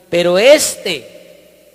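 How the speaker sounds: "este" drawn out very slowly and loud, marked as the stressed pronoun. Shown as a demonstration. Preacher explaining the accent mark on "este", slow loud stress. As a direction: lean hard and slow on "pero este". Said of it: "con tilde en la E".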